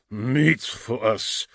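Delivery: slow war cry, building to loud; voice growing stronger. A narrator's reading. Orto at the sight of blood, growing stronger. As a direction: start drawn out, swell into a battle chant. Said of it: "his voice growing stronger".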